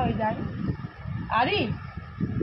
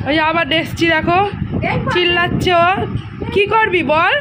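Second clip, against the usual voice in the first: loud scolding; 7 semitones higher, 6 dB louder than usual. Real, not acted. Scolding the crying child. (জোরে বকুনি)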